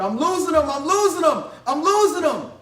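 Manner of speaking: high, very loud alarmed shout; panicked yell. Retold or imitated. Nervous EMS worker yelling to the driver, panicked shouting. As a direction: yell it urgently to someone up front. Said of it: "he's yelling to the driver".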